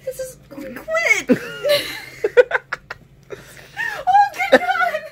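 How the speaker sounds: laugh-crying shout. Real, not acted. Raging at game, laughing.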